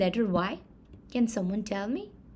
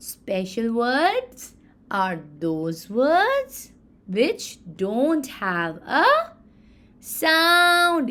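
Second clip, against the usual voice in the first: very slow, louder, drawn-out words; slow definition; 2 semitones higher, 5 dB louder, much slower than usual. Shown as a demonstration. Teacher spelling out a definition for children, slow. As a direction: define it word by word. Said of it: "defining special words".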